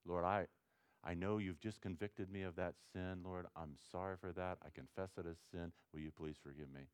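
soft, low sincere apology to God; quiet prayer. Shown as a demonstration. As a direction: pray it softly and humbly.

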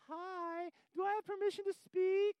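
tiny, very high, soft mock-meek voice; little demon. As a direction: squeak it politely, like a timid little demon. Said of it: "this little demon's going".

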